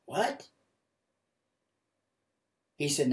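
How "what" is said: high, rising disbelief; shocked what. Retold or imitated. Preacher reacting to God's revelation, shocked. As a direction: replay a stunned, pitched-up question.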